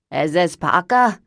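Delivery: loud, put-on voice of an old chain smoker; Lavinia's horrible voice. Cousin Lavinia phoning Parker, loud put-on smoker's voice. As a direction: bark it out like a lifelong smoker. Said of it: "said a horrible voice".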